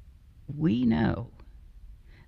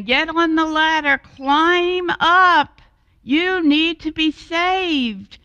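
high, loud commands over rotor noise; urgent shout; 12 semitones higher, 5 dB louder than usual. Acted. Crew shouting to the man on the roof, urgent command. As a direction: shout up orders to climb.

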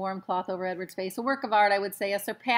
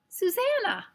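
high, raised call of a name; 12 semitones higher, 4 dB louder than usual. A narrator's reading. Nellie calls out to Susanna, high and excited. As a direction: call it out, pitched well up. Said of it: "called Nellie".

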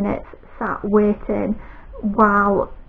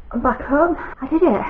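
bright, higher burst of relief after the ordeal; relieved excitement; 5 semitones higher than usual. Real, not acted. Back from the hospital, telling viewers she managed it: relieved excitement. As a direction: announce the win, thrilled it's over.

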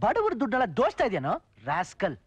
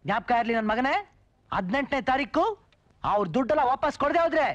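ordinary talk, nothing marked

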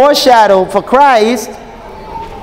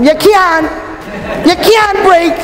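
hint of a Jamaican accent, higher; playful Jamaican-accented preaching; 7 semitones higher, slower than usual. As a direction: lightly slip into a Jamaican accent. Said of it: "a little Jamaican accent came out".

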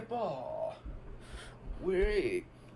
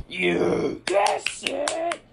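excited call; 8 semitones higher, 9 dB louder, slower than usual. Pretend. Kid ends the guessing round.

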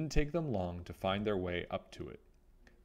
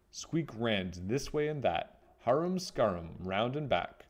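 ordinary delivery, nothing marked

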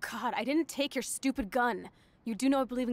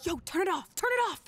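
high urgent hush; hurry, cut the music now; 6 semitones higher than usual. Acted. Panicked hush at stepdad's arrival.

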